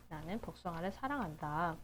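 ordinary delivery

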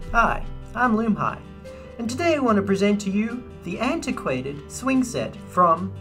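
ordinary talk, nothing marked

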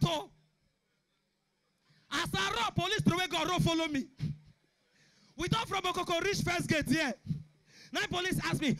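usual speaking voice

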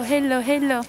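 usual speaking voice

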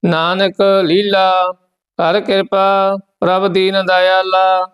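steady, measured chant of the verses; scripture recitation. Acted. Teacher recites Gurbani verses for the students, steady and measured. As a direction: recite each line evenly, with devotion.

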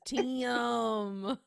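"Tim" stretched into extra syllables, high; a drawn-out Southern "Tim" with added syllables; Brenda. Acted. Doing own character Brenda, "Tim" drawled out long. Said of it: "Brenda. She says".

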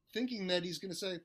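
ordinary talk

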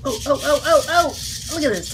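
startled rising "oh, oh!". Real, not acted. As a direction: jump at a bug that moves. Bug suddenly runs away.

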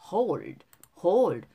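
"hold" with the final d barely released; weak final d. Shown as a demonstration. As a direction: swallow the d ending "hold". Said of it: "드 발음을 약하게 발음하는". ("hold"의 드 발음을 약하게)

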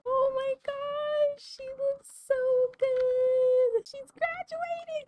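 tiny, very high drawn-out squeal; overcome squeal. Real, not acted. Player gushing over her graduating sim, overcome squeal. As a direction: gush in a stretched, sky-high squeak.

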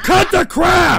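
very loud, high command; angry yell. Real, not acted. Streamer ordering trolls to stop, angry yell. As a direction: shout it down hard. Yells over the troll messages.

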